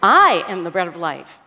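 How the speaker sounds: exaggerated emphasis on "I"; stress on I. Shown as a demonstration. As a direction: hit the word "I" hard. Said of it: "emphasizing different words in the same sentence".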